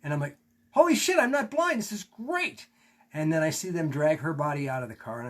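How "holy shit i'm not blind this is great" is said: high, loud burst of relief; a much higher, louder cry of joy; overjoyed. Retold or imitated. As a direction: shout it out, thrilled to see again. Sudden relief at seeing light.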